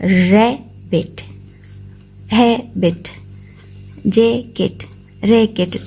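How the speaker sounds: "रैबिट, हैबिट, जैकेट, रैकेट" each broken in two with a short gap between syllables; split into two syllables. Shown as a demonstration. Teacher showing students two-syllable words, "रैबिट, हैबिट, जैकेट, रैकेट" each split in two. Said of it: "तो two part में करते हैं".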